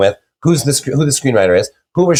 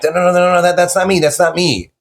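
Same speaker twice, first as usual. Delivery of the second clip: higher-pitched, mildly frantic denial; exasperated protest; 5 semitones higher than usual. Retold or imitated. Author retelling his reaction to book reviews, mildly exasperated. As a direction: replay your objection, pitched up. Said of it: "that drives me nuts".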